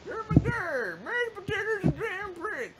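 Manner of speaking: high, loud, slow announcer cry; hype announcer. Pretend. Joking about the Power Stone announcer, loud and high. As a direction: bellow it like a race announcer.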